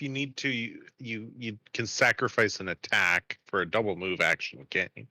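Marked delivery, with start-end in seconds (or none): none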